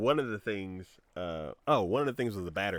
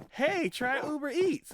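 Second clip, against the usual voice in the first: much higher, slow, sing-song pitch; chirpy ad voice; 9 dB louder, slower than usual. Retold or imitated. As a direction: sell it like a peppy promo email. Voicing Uber Eats promo emails.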